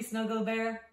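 plain reading voice

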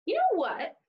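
lively storybook voice for little listeners; reading to children. Acted. Teacher reading a taco story to kids, animated storybook voice. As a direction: read "hola" book style, animated and bright. Reads picture book to children.

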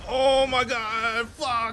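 real dismayed yell. Real, not acted. Streamer reacting to his death, slow loud dismay. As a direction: let the disbelief drag, then spit the curse. Still reeling from the loss.

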